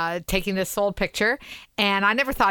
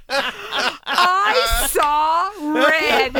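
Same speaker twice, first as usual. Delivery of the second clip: very slow, raised voice through laughter; laughing outrage; 6 semitones higher, 3 dB louder, much slower than usual. Real, not acted. Telling co-hosts how Andrew's age jab landed, laughing outrage. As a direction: draw each word out, cracking up.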